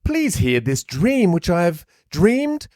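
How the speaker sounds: put-on high, slow posh kid; a prim little rich kid. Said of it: "I was gonna try to do a posh kid's voice".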